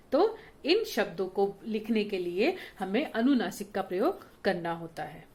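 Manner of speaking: usual speaking voice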